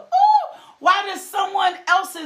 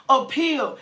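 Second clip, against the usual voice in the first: usual speaking voice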